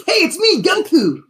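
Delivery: high excited cartoon hero voice; squeaky Goku greeting; Goku impression. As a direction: pitch way up like an eager anime hero. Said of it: "He got another classic".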